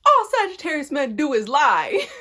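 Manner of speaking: high, loud exclamation breaking into laughter; loud outburst. Real, not acted. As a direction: yell it out, then crack up. Joking outburst about the lie.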